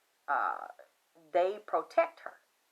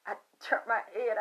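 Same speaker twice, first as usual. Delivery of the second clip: usual speaking voice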